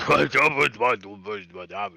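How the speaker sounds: rapid made-up gibberish, rising; mock gibberish. Dwarf babbling nonsense back at the hobgoblin. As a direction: babble a fake language. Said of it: "speaking in a language that you don't quite understand".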